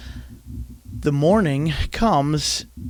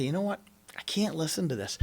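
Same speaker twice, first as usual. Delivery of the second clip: softer, calmly stepping back; quiet refusal; 11 dB quieter, faster than usual. Shown as a demonstration. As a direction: say it under your breath, drawing a line. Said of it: "step back and say".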